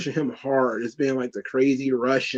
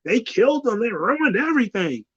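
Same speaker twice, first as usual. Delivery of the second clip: mock fan despair; 8 semitones higher than usual. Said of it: "everybody's like".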